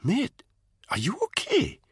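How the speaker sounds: worried friend noticing he's unwell; concerned. A narrator's reading. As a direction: check on him gently. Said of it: "his voice suddenly full of concern".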